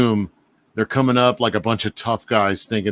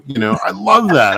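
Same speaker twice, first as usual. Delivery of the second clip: usual speaking voice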